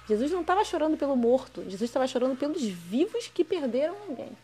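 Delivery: loud, impassioned preaching voice; fervent preaching. Acted. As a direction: preach it out, pressing the point hard. Preaching at full force.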